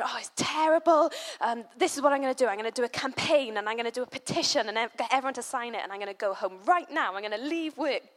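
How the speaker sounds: mock-breathless campaign zeal; imitating excited manager. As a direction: gush like a boss on a crusade. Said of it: "she's all enthusiastic about this".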